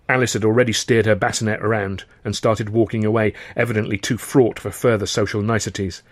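plain reading voice

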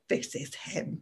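a conspiratorial whisper; whispered aside. Retold or imitated. Stranger in the bar whispering to the injured woman about her man. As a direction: lean in and whisper it, barely voiced. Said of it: "then she goes".

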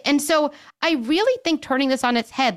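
usual speaking voice

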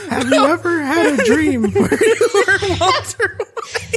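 put-on high old lady voice through laughter; old lady reading, cracking up; very old woman. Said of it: "read it in her old lady voice".

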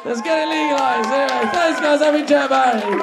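closing the set for the crowd, loud sign-off. Real, not acted. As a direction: shout the goodbye to the room.

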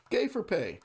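disclaimer broken by a laugh. Retold or imitated. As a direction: laugh through the disclaimer line. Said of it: "a drug infomercial".

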